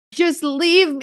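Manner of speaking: high, strained plea; a high desperate cry to just go; pleading. Retold or imitated. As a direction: squeak it out in despair. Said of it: "I was like".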